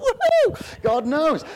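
very high, loud woohoo of delight; thrilled shout; whooping joy. Retold or imitated. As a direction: cheer like you just got proof. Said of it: "So I thought".

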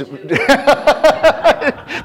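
high, loud run of laughter. Real, not acted. Preacher tickled by thought of Tim's kids, laughing.

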